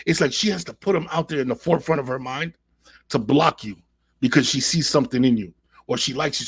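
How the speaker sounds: normal talking voice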